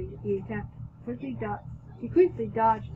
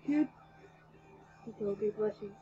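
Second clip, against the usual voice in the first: ordinary delivery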